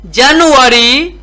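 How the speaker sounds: slow reading drill, a long pause after each word; teacher's deliberate read-along for beginners. Shown as a demonstration. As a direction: read sentences one stretched word at a time. Reads practice sentences word by word.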